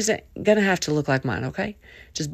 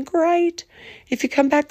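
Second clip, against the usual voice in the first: rising enthusiasm; 9 semitones higher than usual. Acted. Second great, climbing.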